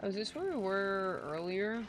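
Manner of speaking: ordinary talk, nothing marked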